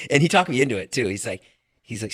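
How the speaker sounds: ordinary delivery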